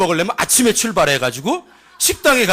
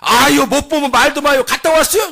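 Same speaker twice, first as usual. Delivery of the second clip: high, acted challenge; indignant retort; 5 semitones higher, 4 dB louder than usual. Cousin challenges the doubting villager, mock indignant. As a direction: shut the doubter down, pitched high.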